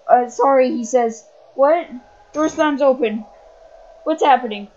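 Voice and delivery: loud, rising-alarm narration; loud tense narration. Acted. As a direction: push the scene loud and urgent. Scary scene, loud narration.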